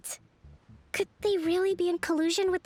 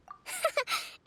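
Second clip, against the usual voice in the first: a short, light laugh of delight; brief amused laugh; soft laugh; 14 semitones higher, 3 dB quieter than usual. Acted. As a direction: laugh softly, pleased. Pleased at shared strategy.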